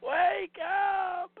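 high, loud pleading yell; exasperated shouting. Real, not acted. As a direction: yell at her to wake up. Yelling at the movie.

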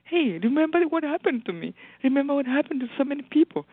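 high, lively urging; animated. Retold or imitated. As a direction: pitch it up and urge a friend to remember. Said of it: "I'm like".

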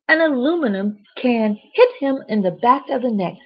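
ordinary delivery

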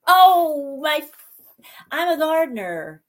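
light up at the news; excited. Real, not acted. Hears husband is landscape architect.

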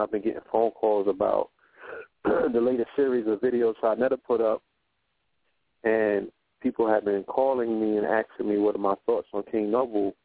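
ordinary talk

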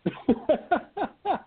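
high-pitched run of chuckles; laughing. Real, not acted. Host amused by the guest's joke, laughing. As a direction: laugh in quick, high bursts. Reacting to guest's remark about listening.

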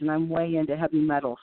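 ordinary delivery

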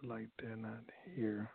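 hushed whisper to himself; whispered aside. Real, not acted. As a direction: drop to a whisper, off mic. Host aside while caller is silent.

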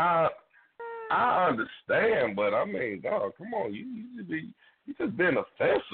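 high, whiny pretend hurt; mock offended whine. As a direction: play wounded over the joke, hammed-up. Mock hurt reaction to joke.

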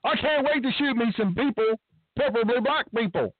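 mock trigger-happy cop. Mocking imagined trigger-happy cop.

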